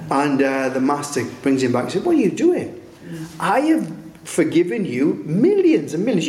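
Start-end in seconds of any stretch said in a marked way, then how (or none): 2.1-6.3 high-pitched incredulous scolding; acted rebuke. Master confronting the unforgiving servant, incredulous rebuke. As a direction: play an indignant master, pitch leaping up.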